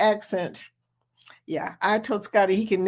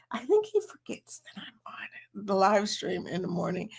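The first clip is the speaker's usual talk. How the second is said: whispering; 2 semitones lower, 8 dB quieter than usual. Real, not acted. Whispering aside about husband.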